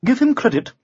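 plain reading voice

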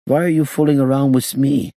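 put-on high-pitched accented complaint; indignant protest. A narrator's reading. Gangster accusing the inspector of toying with him, high indignant voice.

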